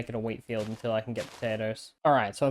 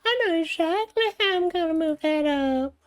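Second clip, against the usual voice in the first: high silly voice; 5 dB louder than usual. Pretend. Gamer hits on a genius idea, high silly boasting.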